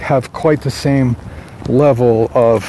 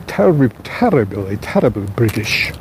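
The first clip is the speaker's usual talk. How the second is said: a hammed-up posh British "terribly, terribly"; mock British accent; 4 semitones lower, 4 dB quieter than usual. Mocking Podium's narrators in a bad British accent. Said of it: "that was a horrible accent".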